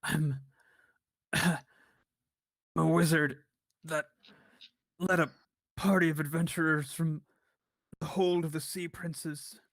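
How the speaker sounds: weak, raspy captive forcing words out; halting raspy voice, pushed out with effort. Acted. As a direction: rasp it out in broken pieces. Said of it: "in a weak and raspy voice".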